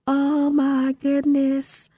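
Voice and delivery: high, sustained and drawn out; giddy exclamation. Real, not acted. Host marveling at being a girl from Fifth Ward, giddy. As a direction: stretch it out on one high note.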